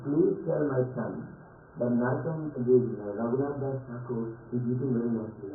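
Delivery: low, hushed retold request; confidential father's plea. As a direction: drop low and soft, as if passing on a secret. Father quietly coaching visitors in story.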